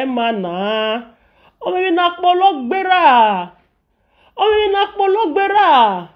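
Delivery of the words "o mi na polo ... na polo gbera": high, worked-up exclamation; pitched-up exasperated cry; indignant outburst. Real, not acted. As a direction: throw it out in disbelief.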